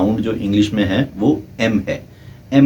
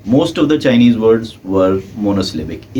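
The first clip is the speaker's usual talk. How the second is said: slow deliberate statement; much slower than usual. Shown as a demonstration. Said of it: "teacher stressing a key fact".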